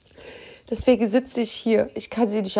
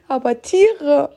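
tearful; 7 semitones higher than usual. Real, not acted. Grieving, says it hurts so much.

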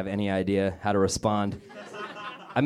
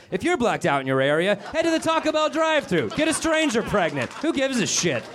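much higher announcer voice, mocking; hammed-up sports announcer; mock broadcast announcer; 10 semitones higher than usual. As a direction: read it like a sneering PA announcement. Parody of blackout announcement.

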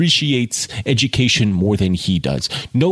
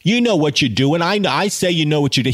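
higher, condescending Boston jerk; 6 semitones higher than usual. Retold or imitated. Boston student mocking Jude, higher and patronizing. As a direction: patronize him, sing-song and high. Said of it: "that asshole from Boston just going".

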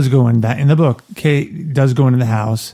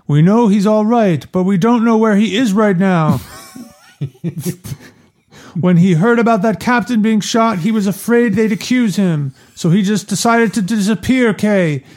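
yelling; 9 semitones higher than usual. Acted. Hagen calling out to Kay from a window, yelling. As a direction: call down to her across a distance. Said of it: "I like that he's, like, yelling to her".

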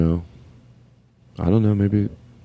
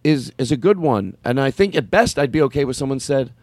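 ordinary talk

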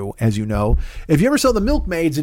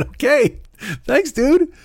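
squeaky thanks; 11 semitones higher, much slower than usual. Retold or imitated. Retold own stunned thanks.

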